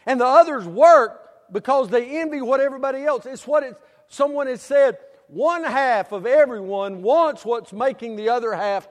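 loud, high, driving sermon voice; full-force preaching. Acted. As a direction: preach it out to the whole hall. Preacher building to a loud point.

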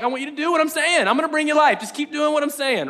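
high, insistent plea to keep going; acted-out impassioned command; urgent urging. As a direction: push it out high and earnest. Voices Jesus urging doers.

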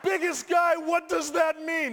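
high, loud shouted question; hammed-up high-pitched alarm; alarmed disbelief. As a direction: shout the words back in comic panic. Alarmed shouted repeat of instructor's words.

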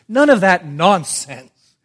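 loud, high, slow rebuke; emphatic dismissal. Real, not acted. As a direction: thunder it from the pulpit. Same dismissal, now emphatic.

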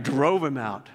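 "Drove" hit hard, falling; emphatic. Shown as a demonstration. Pastor showing Mark's emphatic style, stressing "Drove". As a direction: punch "Drove" like Mark would. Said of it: "He will say things very emphatic".

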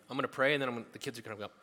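ordinary delivery

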